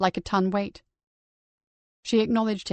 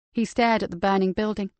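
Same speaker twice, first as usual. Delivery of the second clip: usual speaking voice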